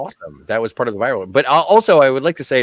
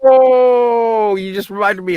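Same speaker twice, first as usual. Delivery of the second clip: much higher and louder, then fast; a co-host interrupting the recap, eager to share; sudden excitement; 9 semitones higher, 4 dB louder, much slower than usual. Real, not acted. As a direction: burst in, thrilled by a memory. Co-host suddenly remembers something.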